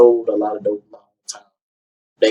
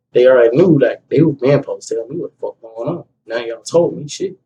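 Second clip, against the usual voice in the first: ordinary talk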